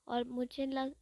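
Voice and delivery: ordinary delivery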